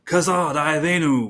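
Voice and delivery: slow chant, pitched high; Gimli battle cry. Shown as a demonstration. Quoting Gimli's fighting words, high drawn-out battle cry. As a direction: draw it out as a rallying cry. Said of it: "and he also says".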